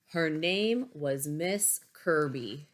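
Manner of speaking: plain reading voice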